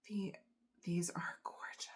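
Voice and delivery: soft, breath-only admiration; awed whisper. Real, not acted. Vlogger admiring gifted earrings, awed whisper. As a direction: whisper it, overcome.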